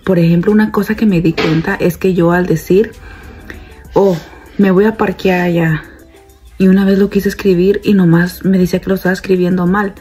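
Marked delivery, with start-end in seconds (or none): none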